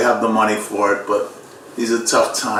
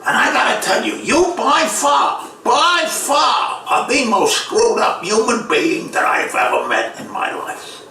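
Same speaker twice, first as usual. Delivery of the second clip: Peter Falk; 13 semitones higher than usual. Acted. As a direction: stretch the words out in a strained character voice. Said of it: "he says to me".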